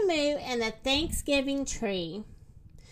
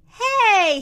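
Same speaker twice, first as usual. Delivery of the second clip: high, loud rooster shout; raised storybook holler; 8 semitones higher, 10 dB louder than usual. A narrator's reading. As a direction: yell out to get attention. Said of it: "he shouted".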